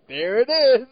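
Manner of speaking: same tipsy blurt; mock drunken exclaim. Comparing delivery to Erik Estrada's, mock drunken exclaim. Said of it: "exactly the same delivery".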